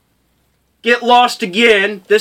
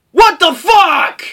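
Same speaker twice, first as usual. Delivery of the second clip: shocked outburst; 8 semitones higher, slower than usual. Retold or imitated. Waking up looted in the inn.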